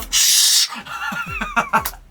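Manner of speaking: high-pitched burst of laughter. Real, not acted. Laughing at own blunder.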